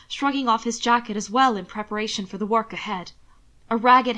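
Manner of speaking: ordinary delivery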